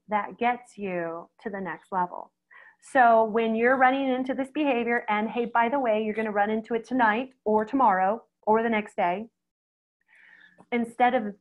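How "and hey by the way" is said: usual speaking voice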